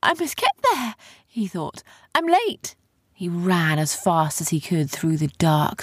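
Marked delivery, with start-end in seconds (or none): 0.0-0.9 high-pitched urgent worry; anxious, high little outburst; panicked. A narrator's reading. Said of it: "He panicked". 2.1-2.8 high, alarmed exclamation; anxious high-pitched worry; panicked. A narrator's reading. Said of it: "He panicked".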